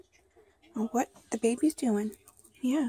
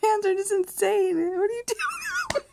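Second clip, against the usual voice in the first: laughing; 8 semitones higher than usual. Real, not acted. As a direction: laugh as you ask. Laughing at baby's face.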